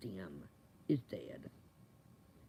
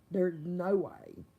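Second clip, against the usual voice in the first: ordinary talk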